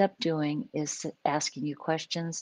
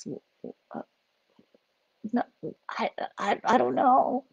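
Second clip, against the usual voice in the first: mock frightened stammer; 8 semitones higher than usual. Neurodiverse loved one, asked to talk, stammers in fear. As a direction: caught off guard and scrambling for words. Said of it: "'cause they're afraid".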